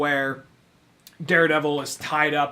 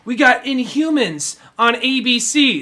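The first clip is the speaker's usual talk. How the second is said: raised, loud, slow hype; mock proud announcement; 8 semitones higher, 7 dB louder than usual. Host mimicking the network touting its show, hyped up. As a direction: announce it like a big network boast, slowly.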